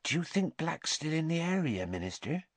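soft, secretive half-whisper. A narrator's reading. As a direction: ask it furtively, nearly whispering. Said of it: "whispered Madame Rosmerta".

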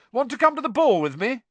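high, loud called invitation; calling out. A narrator's reading. Fred inviting Angelina to the ball, calling out. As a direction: project it across a room.

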